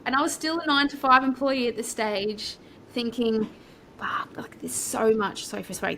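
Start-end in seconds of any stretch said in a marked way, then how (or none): 4.0-5.5 a quick whispered curse to herself, imitating her past thoughts; retold whisper. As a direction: breathe it out as a secret thought, lightly imitated.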